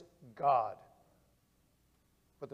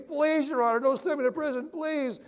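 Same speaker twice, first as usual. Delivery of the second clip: high, begging cry, not truly screamed; a scared girl on her knees begging for mercy; desperate pleading; 7 semitones higher than usual. Acted. Terrified young woman begging the judge not to send her to prison, high pleading. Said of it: "crying, almost screaming".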